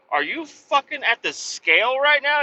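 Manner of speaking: pitched high in disbelief; incredulous. Retold or imitated. Boss on phone to careless driver, high incredulous. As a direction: shoot up high, can't believe it. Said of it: "I'm like".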